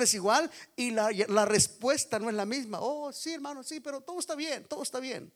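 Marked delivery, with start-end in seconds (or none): 2.8-5.3 higher, softer, breezy brush-off; brushing off the pastor with a light, high voice; mock "all is fine". Caller brushing off the pastor's offer of help, mock breezy denial. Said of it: "he llamado a otras situaciones".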